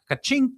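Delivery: high cash-register ka-ching sound; playful sound effect, pitched high; mock ka-ching. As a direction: chime it out like a register. Phone locked in lockbox.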